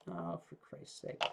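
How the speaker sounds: usual speaking voice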